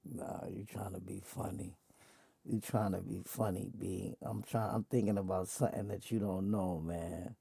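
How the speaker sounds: quiet low muttering; mumbled. Real, not acted. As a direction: mutter it under your breath. User mumbling to AI.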